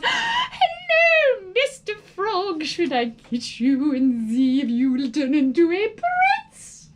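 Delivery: high, loud giggly greeting; girl delighted by a frog; thrilled. A narrator's reading. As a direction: gush over the frog as a prince. Girl greets her frog prize.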